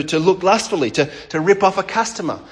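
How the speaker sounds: ordinary talk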